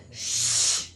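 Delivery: low, loud shush; urgent shush. A narrator's reading. Hagrid hushing Harry before he says too much, urgent shush. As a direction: silence him fast with a shush.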